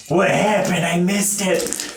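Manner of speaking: high, slow confused little voice; baby voice. Acted. Baby who missed the fight, high and slow. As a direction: play a puzzled little baby. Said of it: "the little baby's like".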